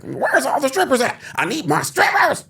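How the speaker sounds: high, loud hammed-up club owner demand; Dave Chappelle. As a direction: holler it high like a comic strip club owner. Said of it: "he's like".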